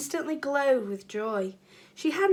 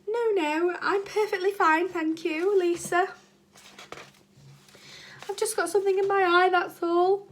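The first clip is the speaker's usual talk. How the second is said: hurt teacher hiding tears; 8 semitones higher, 4 dB louder than usual. Acted. Teacher hiding tears after insult.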